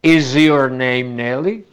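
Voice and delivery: "Is your" slow, z voicing into "your"; slow example sentence for the class; linked is your. Shown as a demonstration. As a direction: let the s voice into "your". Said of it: "parece que dijéramos your".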